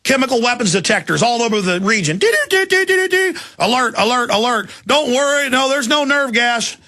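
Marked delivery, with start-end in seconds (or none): 2.2-4.7 high-pitched imitation of a siren then alert calls; imitated alarm beeps and alert; mock alarm. Mimicking alarm sounds. 4.9-6.8 mock reassurance. Mocking officials' reassurance.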